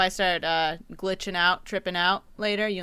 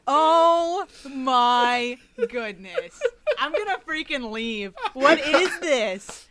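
higher, laughing disbelief; 6 semitones higher, 4 dB louder, much slower than usual. Real, not acted. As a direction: crack up in disbelief. Cracking up at the clip.

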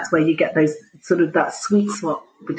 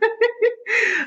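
laughing. Real, not acted. Laughing at her own joke.

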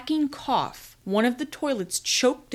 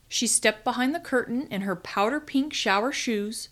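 plain reading voice

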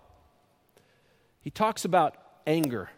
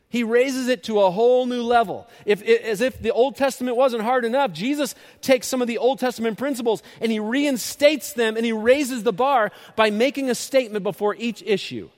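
raised, loud pulpit voice, slowing right down on the last line; full-force preaching; 7 semitones higher, 7 dB louder than usual. Acted. Pastor driving the point home to his congregation, loud and rising. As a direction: preach it hard, climbing to the big finish.

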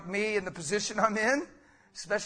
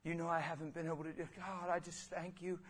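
soft thankful prayer; hushed prayer of thanks at communion; quiet prayer; 3 semitones lower, 12 dB quieter than usual. Shown as a demonstration. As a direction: whisper thanks over the bread. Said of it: "hold up the bread and say".

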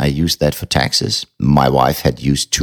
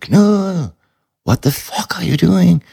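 a much higher, shocked voice calling out of the dark; startled forester; 13 semitones higher than usual. Retold or imitated. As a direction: play the woodsman who finds someone lost in the mud, high and incredulous. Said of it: "I hear this voice going".

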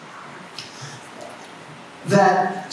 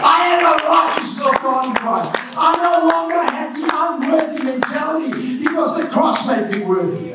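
fiery preaching; 8 semitones higher than usual. Acted. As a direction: preach it hard and high to the church. Preaching at full force.

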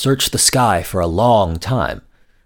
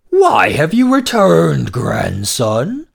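put-on higher, louder and slow, surprised old dragon; dragon grandmother; 7 semitones higher, 5 dB louder, slower than usual. Grandmother dragon questioning her returning grandson. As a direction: demand an answer from the grandson. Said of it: "the dragon's grandmother said".

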